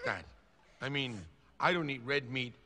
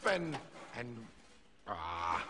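giving up; 10 semitones lower, 7 dB quieter, slower than usual. Acted. Gives up on acting mean.

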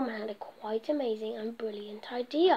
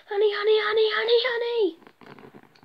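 greedy excitement; 8 semitones higher than usual. Acted. Norman spotting the honey, gleeful chant. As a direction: rush at the honey with glee.

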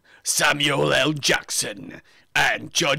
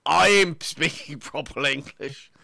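mock cockney accent. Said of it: "it hurts to talk like this".

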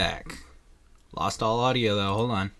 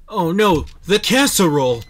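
panicked exclamation; 7 semitones higher, 11 dB louder than usual. Real, not acted. Oven beeping, panic.

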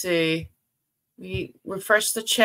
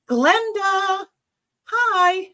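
cheery hello; 12 semitones higher, 5 dB louder, much slower than usual. Real, not acted. Greeting viewer brightly.

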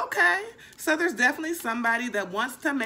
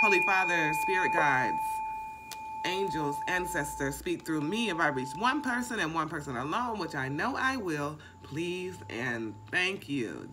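grounding prayer; 5 semitones lower, 4 dB quieter than usual. Acted. Reader calling on spirit guides before reading, hushed prayer. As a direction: murmur it like an invocation.

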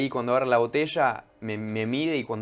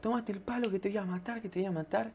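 imitating the threat; 8 semitones higher, 7 dB quieter than usual. Stranger's threat acted again.

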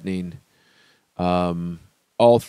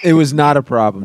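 loud, raised-pitch hurried denial; too-quick denial; 5 semitones higher, 8 dB louder than usual. Real, not acted. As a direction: jump in fast to deny it. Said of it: "You said it so fast".

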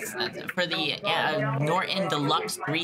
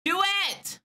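high calls into an empty hold line; summoning the agent who vanished; calling out; 12 semitones higher than usual. Real, not acted. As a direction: call out to the missing agent, pitched way up.

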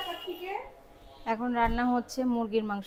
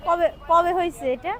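bubbly, pitched-up delight; excited; 4 semitones higher than usual. Real, not acted. Excited street-food discovery.

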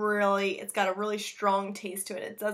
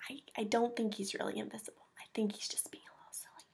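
fast whispered aside; quick, softer whisper, as if sharing a joke; 6 dB quieter, faster than usual. Real, not acted. Aside about the picture.

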